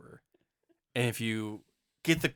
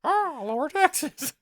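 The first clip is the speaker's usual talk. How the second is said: croaky crow politician; 4 dB louder, slower than usual. Acted. President Crow voice.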